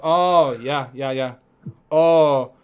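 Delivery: amused, rough imitation of a foreign accent; Gane's accent, attempted. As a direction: draw out the "oh" in a badly imitated accent. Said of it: "I can't do the accent".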